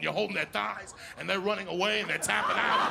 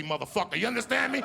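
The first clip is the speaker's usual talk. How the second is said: usual speaking voice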